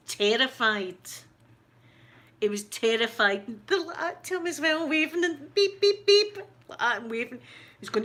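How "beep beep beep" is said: high, sing-song beep beep like a horn; mock car horn beeps. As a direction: toot it out like a cheeky car horn. Girls honking at [name].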